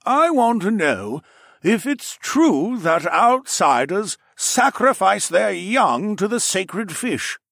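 high, slow wavering elder voice; an elder asking earnestly, slowly; old lizard elder. Acted. Old man with cane questioning Alex, slow high elder voice.

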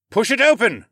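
high, loud instruction; urging on. Acted. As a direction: call up unhelpful advice to a climber. Horace urges on from below.

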